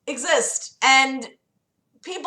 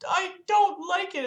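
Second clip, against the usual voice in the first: put-on older lady; 9 semitones higher, 5 dB quieter than usual. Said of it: "this older lady called".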